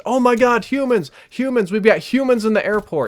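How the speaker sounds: high, loud panicked shriek about humans; retold scream. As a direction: shriek it out in sudden terror. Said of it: "And she just screams".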